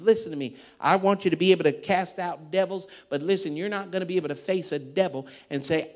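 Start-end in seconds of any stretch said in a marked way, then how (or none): none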